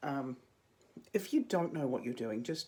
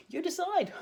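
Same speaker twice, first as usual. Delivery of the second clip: playful squeal; 6 dB louder than usual. Real, not acted. Jokey challenge to viewers.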